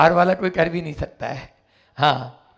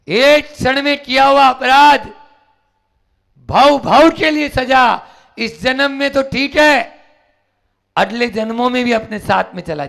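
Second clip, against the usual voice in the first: 1 fiery preaching; 7 semitones higher, 6 dB louder than usual. Acted. Preacher hammering point.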